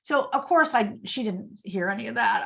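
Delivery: usual speaking voice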